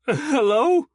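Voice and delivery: loud high call into the dark; shouting. Acted. Prisoner calling down the corridor, shouting. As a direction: call out for anyone. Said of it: "Eustace shouted".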